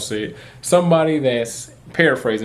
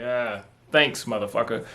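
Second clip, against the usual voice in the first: much softer, under-the-breath sarcastic thanks; sarcastic mutter; 2 semitones lower, 7 dB quieter than usual. Retold or imitated. Taking back his ID from the rude clerk, soft sarcastic mutter. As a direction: grumble it so the clerk barely hears.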